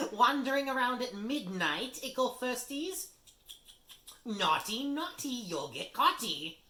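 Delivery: high, loud sing-song taunt; mocking poltergeist. Peeves taunts the first-years, high and loud. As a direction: tease them like naughty children.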